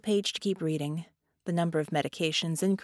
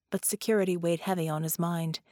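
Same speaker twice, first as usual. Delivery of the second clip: plain reading voice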